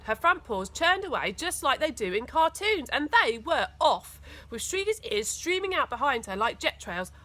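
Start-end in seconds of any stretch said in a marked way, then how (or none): none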